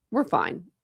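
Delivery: normal talking voice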